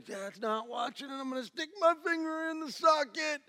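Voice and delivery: put-on high, scheming child voice; sneaky kid voice. Dad voicing his son plotting at the socket. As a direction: play the kid about to misbehave. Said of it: "they're looking".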